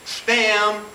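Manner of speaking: normal talking voice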